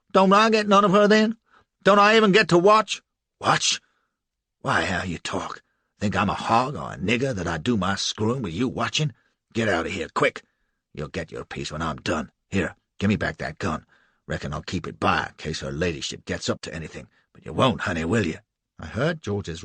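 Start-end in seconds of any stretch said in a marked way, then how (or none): 0.1-3.0 much higher, louder whiny lackey; higher and louder, begging to stay; whining George. Acted. George whines to Tom. 3.4-18.4 lower, rough bully voice giving orders; lower, coarse, bossing George away; gruff slave catcher. Acted. Tom scorns George, leers at Cassie.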